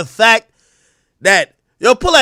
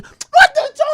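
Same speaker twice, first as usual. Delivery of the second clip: much higher squeal of disbelief; shocked squeal. Retold or imitated. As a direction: squeak it out, appalled. Mock shock at the verse.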